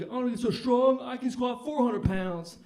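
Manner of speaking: high, loud, slow pumped-up bro; gym bro boast. Retold or imitated. CrossFit guy boasting about his squat goal, loud hyped bro.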